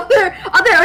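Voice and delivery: ordinary delivery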